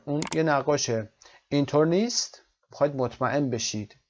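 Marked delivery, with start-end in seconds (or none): 1.5-2.4 "نیست" rises at the end; rising question. Shown as a demonstration. Teacher showing a checking question, rising. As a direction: lift "نیست" up as a real question.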